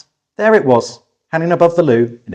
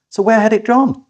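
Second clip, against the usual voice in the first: puzzled question; 10 semitones higher, 3 dB louder than usual. A narrator's reading. Narrator puzzling over missing painting.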